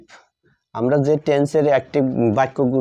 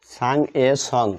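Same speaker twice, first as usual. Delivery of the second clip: very slow, word by word, as if writing it out; slow dictation; much slower than usual. Shown as a demonstration. Teacher writing on the board, dictating slowly to the class. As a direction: draw each word out for the class to copy.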